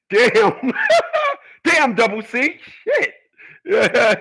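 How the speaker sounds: cracking up at the comment; laughing. Real, not acted. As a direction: say it through a burst of laughter.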